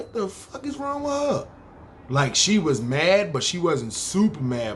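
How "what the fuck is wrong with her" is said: shocked. Retold or imitated. Shocked at her cursing him.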